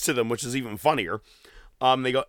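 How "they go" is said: normal talking voice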